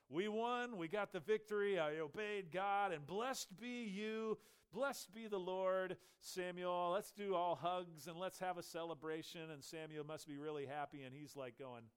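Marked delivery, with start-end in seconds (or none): 0.1-9.5 loud, high, triumphant boasting; boastful Saul. Acted. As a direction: crow about the victory like a proud king.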